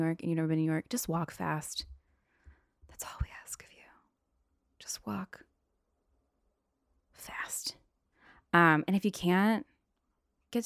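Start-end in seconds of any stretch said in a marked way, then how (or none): 2.9-7.8 barely audible whispered request; hushed plea. Acted. New Yorker begging tourists to walk fast, whispered. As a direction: whisper it slowly, word by word.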